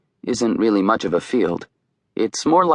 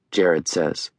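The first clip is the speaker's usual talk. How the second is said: ordinary delivery, nothing marked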